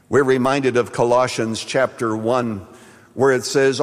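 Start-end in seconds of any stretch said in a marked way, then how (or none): none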